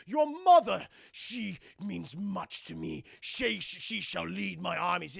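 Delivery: slow, hammed-up tyrant, loud opening; pompous mock villain, drawing words out; Shao Kahn. Shao Kahn praises the mother to Katana, slow villain voice. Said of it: "He goes".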